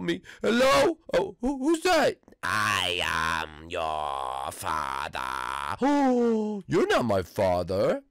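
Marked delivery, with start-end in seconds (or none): 2.4-5.8 low, very slow ominous voice; deep villain voice. Pretend. A stranger teasing the player, low slow villain voice. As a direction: stretch it out like a movie villain.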